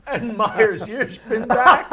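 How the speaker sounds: ordinary talk, nothing marked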